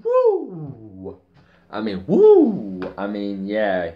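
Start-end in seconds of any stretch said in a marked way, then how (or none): 0.0-1.2 high drawn-out whoop; whooping, falling off at the end; excited woo. Real, not acted. Excited outburst opening review. 2.1-2.8 loud, high, drawn-out whoop; big long "woo", much louder; excited woo. Real, not acted. Excited outburst opening review.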